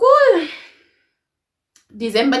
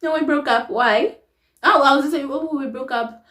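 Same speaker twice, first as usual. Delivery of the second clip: acting out a chat; 6 semitones higher than usual. Shown as a demonstration. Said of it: "if you ask me".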